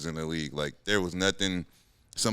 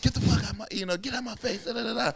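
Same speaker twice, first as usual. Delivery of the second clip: imitating a coach chewing him out, pitched high; mock high-voiced coach yell; 14 semitones higher, faster than usual. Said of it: "after he's like".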